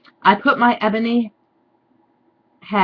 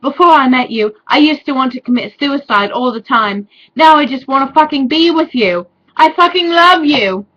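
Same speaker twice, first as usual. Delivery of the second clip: a mock Chinese accent, higher and louder; Draco's love speech in a hammed-up Chinese accent; 6 semitones higher, 7 dB louder than usual. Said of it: "suddenly went to a Chinese accent".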